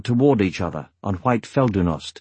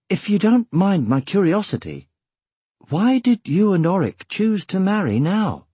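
higher-pitched, politely inquiring voice; curious young hero; 8 semitones higher than usual. Acted. Eragon asks the newlyweds about marrying now, politely curious.

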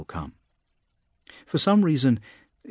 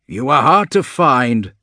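louder, slow and irritated; irritable monster; 3 semitones higher, 8 dB louder than usual. A narrator's reading. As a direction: grumble it at him with annoyance. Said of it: "says Philemon irritably".

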